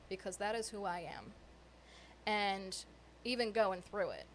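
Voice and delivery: ordinary talk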